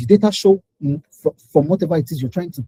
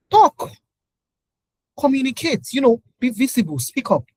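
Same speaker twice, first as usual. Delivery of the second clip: emphatic; 6 semitones higher than usual. Real, not acted. Speaker commanding listeners to talk, forceful. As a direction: punch it as a command.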